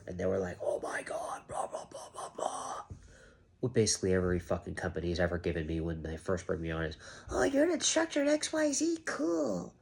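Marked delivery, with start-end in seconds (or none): 0.6-2.8 whispered mock brush-off, much softer. To the viewer, mocking the company in a whisper. Mocking employer's dismissive reply. 7.3-9.7 much higher, airy mock enthusiasm. Mimicking every company that hired him, mock chirpy. Mocking company's shallow reply.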